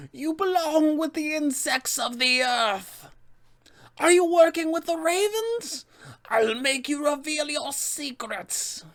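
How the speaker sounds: belittling, berating witch in a high voice; Baba Lysaga. Acted. Witch berates players who stay in the fight, high and scornful. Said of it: "she will belittle them and berate them".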